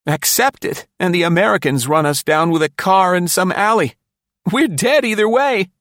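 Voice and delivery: high, frightened and bitter rant; Densham's despair. A narrator's reading. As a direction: spill out the dread of being doomed. Densham's fearful tirade.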